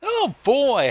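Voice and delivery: usual speaking voice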